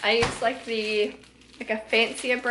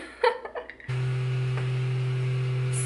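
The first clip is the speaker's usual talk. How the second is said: laughing; 12 semitones lower, 3 dB quieter than usual. Real, not acted. Laugh alone on line.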